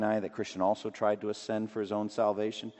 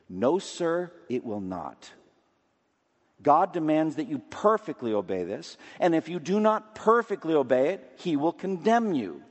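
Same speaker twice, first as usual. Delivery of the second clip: raised, louder preaching voice; preaching read; 6 semitones higher, 5 dB louder than usual. Acted. Preacher reading a quoted sermon aloud to his congregation, in a raised preaching voice. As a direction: read the old sermon as if from the pulpit.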